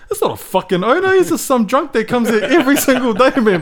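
high, loud mock exasperation; retold scornful correction. Bar worker exposing the regular drunk, exasperated and scornful. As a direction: imitate someone loudly setting a fool straight.

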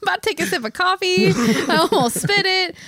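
high, laughing outburst; laughing disbelief. Real, not acted. Startled by a joke while drinking coffee.

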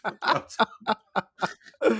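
high, slow laughing question. Real, not acted. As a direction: ask it through a laugh. Laughing disbelief.

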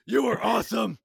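Batman voice. Acted. Batman voice in table read.

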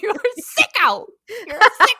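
high, loud accusation; loud comic indictment; mock outrage. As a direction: cry out in playful disgust. Mock accusing her co-host.

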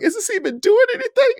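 very high, whining client; whiny client. Retold or imitated. Sweating client asking his trainer, high and whining. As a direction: whine it up high. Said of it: "he was like".

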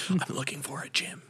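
playful whispered joke; whispering. Pretend. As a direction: whisper it as a bit. Whispered coffee-shop role play.

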